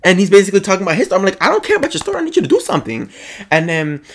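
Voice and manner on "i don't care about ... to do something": high, impatient retold demand; exasperated, raised-pitch comeback; indignant. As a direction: act out cutting him off, pitched up. Retells confronting the manager.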